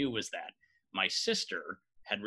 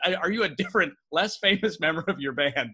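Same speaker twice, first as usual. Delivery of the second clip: louder excited retelling; 5 semitones higher, 6 dB louder than usual. Retold or imitated. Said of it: "And when he wasn't".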